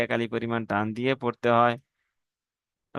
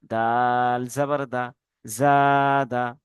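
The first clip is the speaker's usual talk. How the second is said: each syllable cut apart and drawn out; slow separated spelling; slower than usual. Shown as a demonstration. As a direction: chant the letters one at a time, slowly. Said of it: "এরকম ভাবে বানান করবেন".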